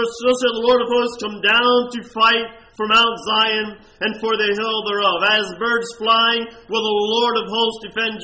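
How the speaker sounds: preacher voicing God's lament; preaching at full force. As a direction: cry out God's pleading words.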